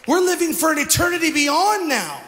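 loud, high preacher's exhortation; fiery preaching. Real, not acted. Pastor rousing the congregation, loud and high. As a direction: preach it out to the crowd.

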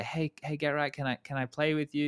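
shy, tentative mock plea; timid request. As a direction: ask meekly, as if nervous to bother someone. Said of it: "I'm not brave enough just to message".

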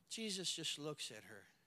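usual speaking voice

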